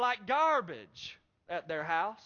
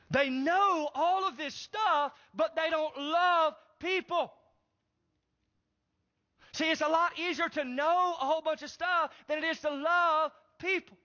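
preaching at full force; 8 semitones higher, 4 dB louder than usual. Acted. Preacher telling the congregation they don't love people, at full force. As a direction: lift it like a preacher driving the point home.

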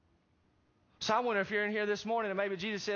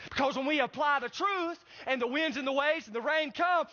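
preaching at full force; 6 semitones higher, 3 dB louder than usual. Acted.

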